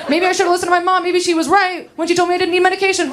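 high, rushed, spiralling worry; racing anxious thoughts. Acted. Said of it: "a lot of racing thoughts".

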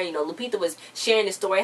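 usual speaking voice

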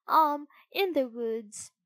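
slow, high, stalling fib; lying. A narrator's reading. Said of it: "lied the puppet".

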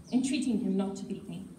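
ordinary delivery

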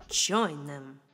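usual speaking voice

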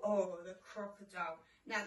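normal talking voice

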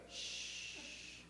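a whispered shh; shushing. Retold or imitated. Airline agent hushing the preacher, whispered. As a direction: hush him with a breathy shh.

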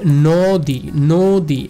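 নদী said slowly as "nooo-dii"; drawn-out syllables. Shown as a demonstration. Teacher demonstrates the word for students, slow drawn-out syllables. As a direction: stretch both syllables of নদী, loud and clear. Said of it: "এই নদীটা আমি যখন উচ্চারণ করব".

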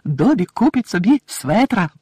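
high, loud, joyful announcement; delighted little elf squeak; happy elf. A narrator's reading. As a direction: announce it proudly, drawing out the end. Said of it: "щасливо повідомив він".

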